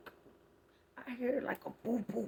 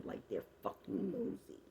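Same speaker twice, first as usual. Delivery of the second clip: unvoiced hushed whisper; conspiratorial whisper about nosy neighbours; 7 dB quieter than usual. Real, not acted. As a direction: whisper it like the neighbours might hear.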